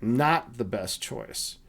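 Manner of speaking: low, slow, softened aside; quietly disdainful, drawn out; server's parting dig. Retold or imitated. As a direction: imitate a disapproving waiter walking off. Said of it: "as he's walking away, he says".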